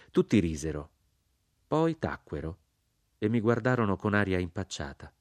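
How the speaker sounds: plain reading voice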